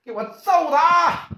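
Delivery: high, slow drawn-out order; ordering attack. Acted. Ye Laosan ordering his men to attack, high slow command. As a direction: stretch out the command to beat him. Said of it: "叶老三讲话".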